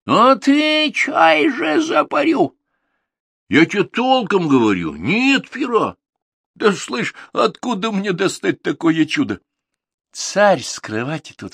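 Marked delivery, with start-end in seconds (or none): 0.1-2.6 very high, loud, slow threatening tsar; furious tsar. Acted. 3.5-9.4 raised, insistent Ivan stressing нет; lightly voiced lad swearing it's true; protesting Ivan. Ivan denies.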